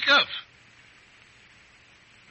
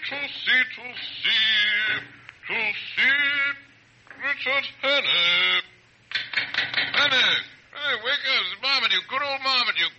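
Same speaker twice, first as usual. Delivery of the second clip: put-on rousing a neighbour, drunk; drunken call; 5 semitones higher than usual. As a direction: call up to the flat. Drunk calling up the stairs.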